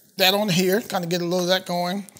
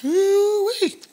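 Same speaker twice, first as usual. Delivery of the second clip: much higher delighted woo-wee; delighted whoop; 13 semitones higher than usual. Real, not acted. Cook thrilled by the spiced potatoes, high whoop. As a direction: let woo-wee fly up in joy.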